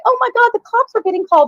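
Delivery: high-pitched retold panic; mock alarm. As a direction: act out hearing shocking news. Acts out group's alarmed reaction.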